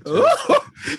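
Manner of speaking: shrieking laugh, very high; high delighted squeal. Real, not acted. Delighted squeal at being teased.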